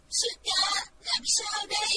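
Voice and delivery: loud, high tearful plea; crying. A narrator's reading. Frightened girl crying to her senior. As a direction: cry out in helpless despair. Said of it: "Thích Phương khóc nói".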